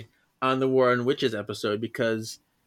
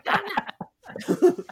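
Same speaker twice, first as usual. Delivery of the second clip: high giggly chant of the name; laughing. Real, not acted. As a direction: squeal it through laughter.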